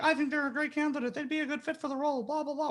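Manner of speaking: mock the recruiter write-up in a tiny Mickey squeak. Recruiter mocking his own biased candidate summary, high cartoon squeak. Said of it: "whatever stupid thing I say in my Mickey voice".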